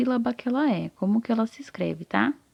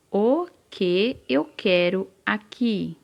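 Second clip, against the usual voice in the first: slow dictation; slower than usual. Shown as a demonstration. Teacher dictates the sentence.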